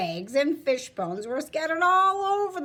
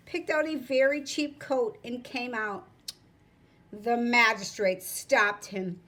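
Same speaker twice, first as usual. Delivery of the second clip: ordinary delivery, nothing marked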